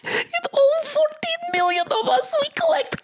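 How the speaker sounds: ant voice. Pretend. Playing talking ants.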